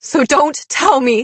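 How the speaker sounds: loud, high, anguished outburst; shaken fury. A narrator's reading. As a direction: let the accusation burst out, overwrought. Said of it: "My voice shook".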